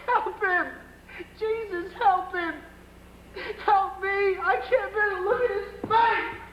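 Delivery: crying out, begging for help, voice breaking; desperate sobbing plea. Acted. Biff breaks down begging for father.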